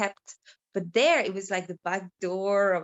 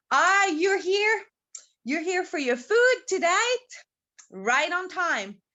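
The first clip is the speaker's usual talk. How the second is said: welcoming big man; 7 semitones higher than usual. Acted. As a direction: greet a regular visitor, stretching it out high. Big man greets the dog.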